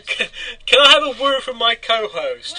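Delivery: laughing through the words; giggling while asking. Real, not acted.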